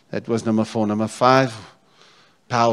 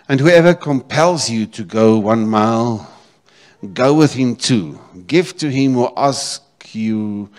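scripture reading; 8 dB louder, slower than usual. Acted. Preacher reading scripture aloud.

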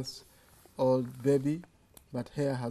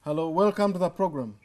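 louder, pitch up, calling down a faint phone line; raised voice for a bad line; 4 semitones higher, 7 dB louder than usual. Real, not acted. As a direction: speak up so the caller hears.

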